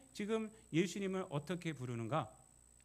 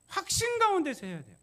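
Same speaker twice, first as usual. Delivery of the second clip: higher and louder, hammering 확신; preaching 확신 loud and high; emphatic proclamation; 11 semitones higher, 8 dB louder than usual. Real, not acted. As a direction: proclaim 확신 with full force. Preacher stresses certainty.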